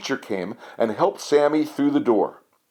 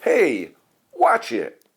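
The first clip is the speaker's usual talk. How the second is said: higher-pitched storybook shout of warning; mild shout; 4 semitones higher, slower than usual. A narrator's reading. Someone on the bus to Ned, shouted warning. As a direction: call out a sharp warning, only lightly raised. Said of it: "someone shouted".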